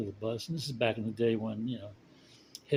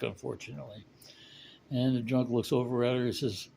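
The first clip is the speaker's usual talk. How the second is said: usual speaking voice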